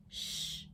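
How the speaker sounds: unvoiced hush; soft shush. Reader hushing the listener, soft shush. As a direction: shush the room gently. Hushing before good night.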